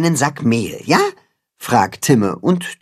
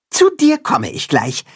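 cat-hen; 9 semitones higher than usual. A narrator's reading. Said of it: "sagt das Katzenhuhn".

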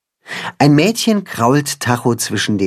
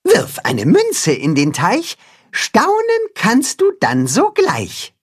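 revealing the rhyme; 11 semitones higher than usual. Acted. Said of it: "verrät das Katzenhuhn".

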